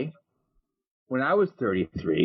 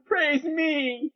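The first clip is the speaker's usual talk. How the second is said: very high ecstatic cry. Acted. Man falling to the ground waving his hands, ecstatic. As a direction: cry it out in mock religious rapture.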